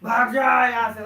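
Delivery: loud, slow yell to drive someone off; angry shout. Shown as a demonstration. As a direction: bellow it like chasing someone away. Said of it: "जैसे गुस्सा में चिल्लाते हो ना".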